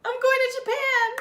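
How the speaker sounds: high excited reveal; announcing big news. Real, not acted. Big reveal after long pause.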